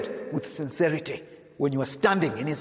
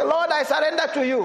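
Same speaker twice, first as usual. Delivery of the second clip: a lightly mocking, high-pitched parroted prayer; mocking rote prayer; 13 semitones higher, 4 dB louder than usual. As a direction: parrot the words as an empty formula. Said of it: "What are you doing?".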